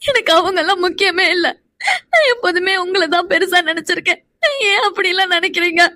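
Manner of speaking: tearful reassurance; weepy and hurt, begging to be believed; crying. Acted. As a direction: plead your devotion through tears. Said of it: "இப்ப நீ எதுக்குடி அழுதுட்டு இருக்க".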